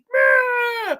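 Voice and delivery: very high loud cranky bleat; Eustace grumble. Retold or imitated. Imitating Eustace being abducted, high and loud. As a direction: blurt it like a grumpy old farmer. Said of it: "He was like".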